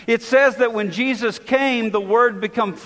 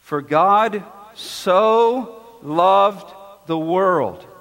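"so" drawn out and leaned on, slow half-sung; slow intoned verse with "so" weighted; stress on so; much slower than usual. Shown as a demonstration. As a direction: linger on "so" like a chant. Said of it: "It's the emphatic word".

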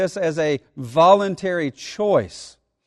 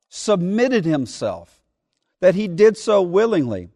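ordinary talk, nothing marked

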